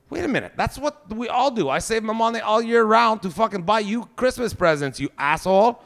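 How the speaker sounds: put-on dad ranting at teenage daughter over presents; indignant rant. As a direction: rant at her like a wronged father.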